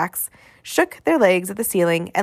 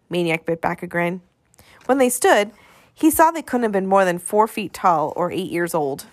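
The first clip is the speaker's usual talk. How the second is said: ordinary delivery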